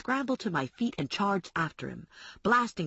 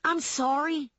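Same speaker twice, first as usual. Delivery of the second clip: yelled; 8 semitones higher, slower than usual. Acted. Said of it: "I yelled at her".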